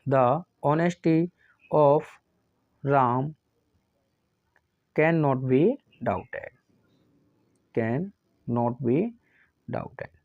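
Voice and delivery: very slow, word by word, pausing for students to write; slow dictation. Shown as a demonstration. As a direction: dictate it slowly to the class. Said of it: "तो क्या होगा?".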